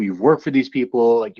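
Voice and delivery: usual speaking voice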